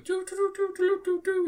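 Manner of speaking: high toy-trombone toots; tiny trombone noise. Retold or imitated. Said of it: "he goes".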